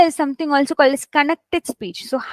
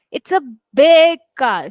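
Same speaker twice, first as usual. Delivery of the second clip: "big" punched louder; stress on BIG; 5 dB louder than usual. Shown as a demonstration. Teacher showing adjective stress to students, "big" stressed. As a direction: hit the word BIG hard. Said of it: "So I'm also stressing on how the car is".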